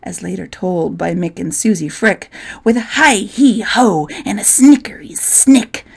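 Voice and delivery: plain reading voice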